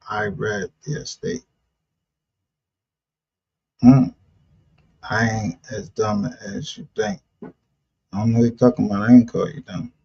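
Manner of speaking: normal talking voice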